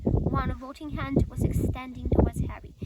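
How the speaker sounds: plain reading voice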